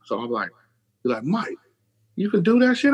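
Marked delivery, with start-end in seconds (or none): 1.2-2.9 amazed question. Retold or imitated. Brother's amazed question, high.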